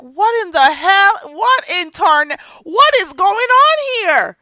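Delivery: outraged shout. Retold or imitated. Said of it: "And I'm like".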